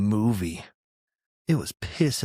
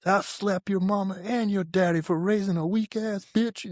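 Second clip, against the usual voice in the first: much higher mock threat; drill sergeant tirade; 10 semitones higher than usual. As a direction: threaten the parents like the raging sergeant. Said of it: "he's like".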